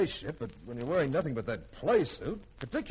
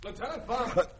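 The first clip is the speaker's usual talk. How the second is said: high, sharp reprimanding call; 10 semitones higher than usual. Acted. Commander catching the lieutenant kissing, a sharp high call. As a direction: catch him in the act and bark his name.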